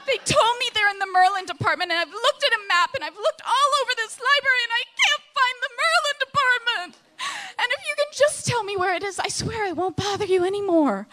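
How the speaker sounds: desperate pleading. Retold or imitated. Lost in the library, pleading with the librarians, near tears.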